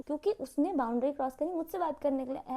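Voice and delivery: normal talking voice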